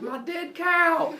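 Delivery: high, slow, loud mock wail; hammed-up anguish. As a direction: wail it out in horror, overdone. Apollo finds his mutilated cow.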